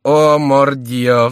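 delighted, stretched-out exclamation; amused exclamation. A narrator's reading. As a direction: draw the oath out in mirth. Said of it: "вскричал Келюс, громко захохотав".

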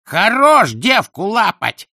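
slow, high, loud heckle; nasty little shout. A narrator's reading. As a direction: yell it meanly from the crowd. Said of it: "крикнул из толпы всё тот же подлый голосишка".

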